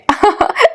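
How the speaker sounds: high, teasing giggle; amused high-pitched laughter; acted laugh. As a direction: burst into a high playful laugh. Granddaughter laughs at climbing grandfather.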